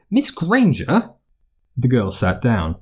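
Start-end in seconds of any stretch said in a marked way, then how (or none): 0.1-1.1 put-on high, slow, startled old witch; choked old witch. McGonagall recognising the exhausted girl, startled. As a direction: gasp out her name in shock. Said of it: "He heard the old witch choke".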